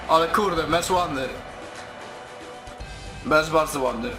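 normal talking voice

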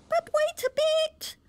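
very high squeaky oyster voices; little oysters crying out. A narrator's reading. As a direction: squeak it like tiny worried oysters. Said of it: "the oysters cried".

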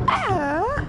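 high mock scream; imitated scream. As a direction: squeal like she did. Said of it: "She's go".

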